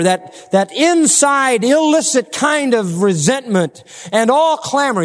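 high, drawn-out, ringing preacher's delivery; forceful preaching. Acted. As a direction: stretch each sin out, high and emphatic.

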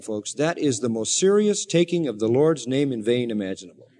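usual speaking voice

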